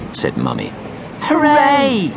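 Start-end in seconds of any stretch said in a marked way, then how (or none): 1.2-2.1 high cheering shout; shouted hooray. A narrator's reading. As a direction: cheer like delighted children. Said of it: "shouted Topsy and Tim".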